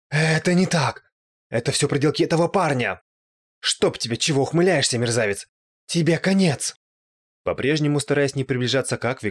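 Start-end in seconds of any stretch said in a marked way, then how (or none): 0.1-6.7 higher, raised voice; furious outburst. A narrator's reading. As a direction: lash out at the impostor. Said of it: "пылая от ярости". (яростная угроза)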